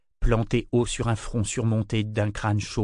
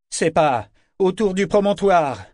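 raised, higher voice against the storm; calling out; 8 semitones higher, 4 dB louder than usual. A narrator's reading. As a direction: shout it over wind and rain. Shouting on through the storm. (crié par-dessus la tempête)

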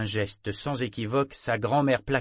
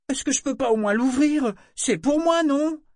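put-on much higher, louder pleading girl; arguing girl; 7 dB louder than usual. A narrator's reading. Girl arguing with her grandmother to open it. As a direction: argue for the box.

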